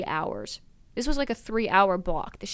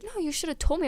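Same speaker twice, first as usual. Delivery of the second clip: high-pitched imitated insistence; imitating a friend's protest, pitched up; mock reproach; 10 semitones higher than usual. Friend scolding roommate for silence, mock reproach.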